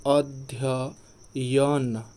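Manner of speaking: अध्ययन said very slowly, each य heard; careful spelling-out. Shown as a demonstration. Teacher slowly pronouncing अध्ययन for the class. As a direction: draw out अध्ययन to show the double य. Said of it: "यहाँ पे double य रहेगा".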